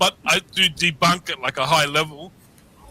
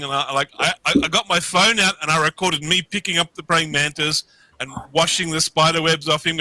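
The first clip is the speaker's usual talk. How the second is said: ordinary delivery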